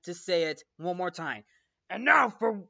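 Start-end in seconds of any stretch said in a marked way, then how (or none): 1.9-2.6 building to a high full yell; furious mock shout. Fletcher makes Neiman say it one last time, furious shouting. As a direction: roar the order, louder with each phrase. Teacher's final furious demand.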